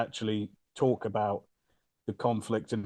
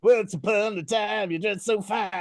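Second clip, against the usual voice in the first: Chalamet doing Dylan; 7 semitones higher, 4 dB louder than usual. Retold or imitated. Mocking Chalamet's Dylan for friends on a film podcast. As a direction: croon it lazily like Chalamet's Dylan. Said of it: "really good impression of Timothée Chalamet doing [name]".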